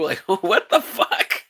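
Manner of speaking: slow, laughing "what the"; laughing disbelief. Real, not acted. Reacting to the Rogue scene, laughing. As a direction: laugh through the question.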